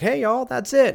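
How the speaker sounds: high, loud mock Southern greeting; Southern accent. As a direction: holler it in a put-on Southern accent. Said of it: "when you moved to the South".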